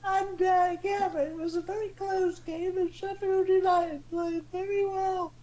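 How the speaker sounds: high warbling falsetto dame; Dame Edna. Acted. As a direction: put on a high old-lady showbiz voice. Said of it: "You're meant to be Dame Edna Everage".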